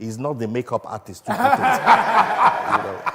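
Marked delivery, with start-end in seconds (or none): 1.9-2.7 hearty laugh. Real, not acted. Laughs at own joke.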